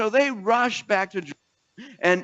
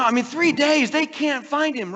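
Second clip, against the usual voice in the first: high emphatic; 9 semitones higher, 3 dB louder than usual. Acted. Emphatic rising storytelling.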